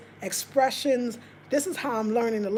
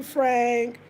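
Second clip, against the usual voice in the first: ordinary delivery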